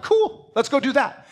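high, breezy agreeable chirp; easygoing friend. Retold or imitated. Preacher quotes easygoing friend Abby, high and breezy. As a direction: toss it off like anything's fine with you. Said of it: "She's like".

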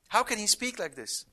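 ordinary talk

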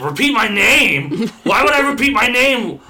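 high, loud indignant shout; outraged caller. Retold or imitated. Trainer acting an irate caller to the trainee, shouting. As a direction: blow up like an insulted customer.

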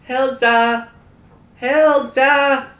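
shouting a name across the yard; calling out. Acted. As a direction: call loudly for Hilda. Calling out for the dog.

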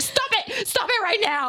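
high frightened mock cry; panicked pleading. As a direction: beg him to stop, squealing. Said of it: "I was like".

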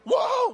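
demo whoa. Shown as a demonstration. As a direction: show them the shout. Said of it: "I want you to go".

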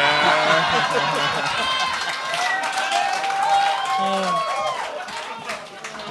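long, very high, loud laugh. Real, not acted. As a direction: howl with laughter.

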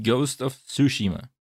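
"Tsushima" pronounced deliberately, stressed on the middle syllable; careful correct pronunciation. Real, not acted. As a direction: say "Tsushima" carefully, as soo-SHEE-ma. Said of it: "I tried to pronounce it as it should".

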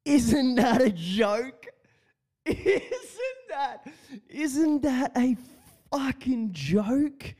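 high, slow disbelief, laughing through it; mock outrage at the news; incredulous. Real, not acted. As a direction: scoff that it must be a joke. Mocking the sexiest accent claim.

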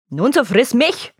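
a fed-up father spitting it out slowly; full of anger. Acted. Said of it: "antwortete der Vater voll Ärger".

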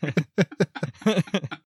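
words breaking into laughter, high; laughing. Real, not acted. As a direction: try to repeat it through giggles. Laughing while repeating the line.

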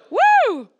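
high, loud cheering woo; joyful whoop. Real, not acted. Preacher cheering the congregation with a whoop. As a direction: let out a celebratory whoop.

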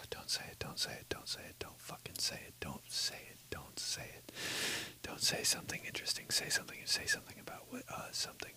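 whispered, rushed inner monologue begging himself; frantic inner whisper. Retold or imitated. Comic telling himself in a frantic whisper what not to say. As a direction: hiss it fast under your breath like a panicked thought.

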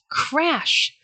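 high, drawn-out storyteller's exclamation; dramatic crash word. A narrator's reading. Narrator tells children of the tree falling, dramatically. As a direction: make the word burst high, then drop.

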